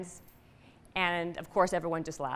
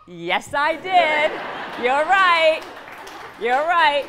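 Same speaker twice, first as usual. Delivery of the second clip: much higher, much louder yes, yelled in triumph; triumphant shout; 8 semitones higher, 10 dB louder, slower than usual. Real, not acted. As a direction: shout it out like you won. Triumphant answer to audience.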